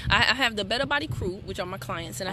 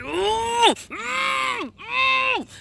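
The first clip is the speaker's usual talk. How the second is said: high, loud fussing ooh sounds; mother hamming up a scolding; mock fussing; 13 semitones higher, 5 dB louder, much slower than usual. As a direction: scold him with big high ooh's.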